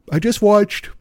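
words stretched by an oncoming yawn; fighting a yawn. Real, not acted. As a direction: talk through a rising yawn. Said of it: "I'm fighting yawns all of a sudden".

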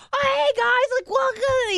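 high-pitched gushing mock welcome; over-the-top enthusiasm. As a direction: imitate an overeager radio host's greeting. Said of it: "like, enthusiasm".

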